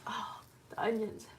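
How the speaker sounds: breathy whispered aside; hushed aside about the onions. Real, not acted. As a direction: whisper it to yourself over the cutting board.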